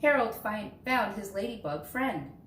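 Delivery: plain reading voice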